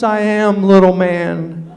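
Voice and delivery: a hint of a tough girl, slow and loud. Desiree sizing up her mom's short date, slow and loud. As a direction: draw it out looking down on him. Tall girl talks down to him.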